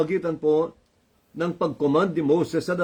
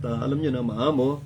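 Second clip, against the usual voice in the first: ordinary talk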